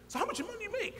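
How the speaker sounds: high, incredulous question; high mock-incredulous retort. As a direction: toss it back with disbelief. Retorting to the dean.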